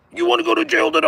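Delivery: a squeaky, puffed-up cop making threats; mocking the cop. Cop threatening the skaters, mock high-pitched yell.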